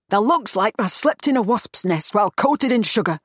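put-on a touch of a tart older woman; dry jab, lightly voiced; blunt Yorkshire woman. Ida mocks Samson's face.

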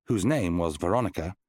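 usual speaking voice

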